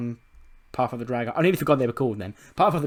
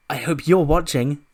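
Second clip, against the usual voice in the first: loud, high, slow parting shot; hammed-up character challenge; pointed challenge; 4 semitones higher, 8 dB louder than usual. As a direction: stretch it out loudly as a taunt. Voicing Breeze's parting challenge to Shane.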